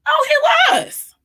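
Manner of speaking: high, sarcastic "Oh, he was"; mock outrage. Mother mocking her son's excuse, high and sarcastic.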